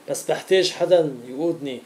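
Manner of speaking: usual speaking voice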